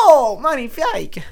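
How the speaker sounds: high, loud, slow mock delighted teacher gush; gushing English teacher. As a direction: ham up a thrilled English teacher. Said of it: "ça leur fait".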